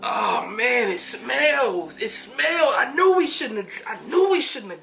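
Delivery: loud, high disgusted panic; panicked disgust. Pretend. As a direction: react to a terrible stink in alarm. Acting zombie transformation, poop smell.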